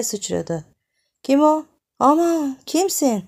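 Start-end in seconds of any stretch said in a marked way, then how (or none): 1.2-3.2 high, loud startled cry; jolted awake, crying out in alarm. Acted. Woman woken in the dark cries out in alarm.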